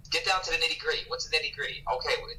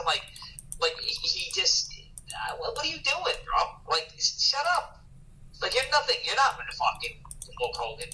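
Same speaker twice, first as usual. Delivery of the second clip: high-pitched, incredulous telling-off; exasperated rant; 10 semitones higher than usual. Acted. Rants at Jake the asshole, high and exasperated. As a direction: scold him like a pest you can't stand.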